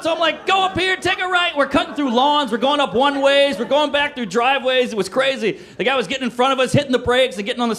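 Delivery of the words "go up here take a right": high, urgent mock panic; panicked directions. Teen directing the driver during a chase, high panic. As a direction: call out turns as if fleeing. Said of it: "We're freaking out".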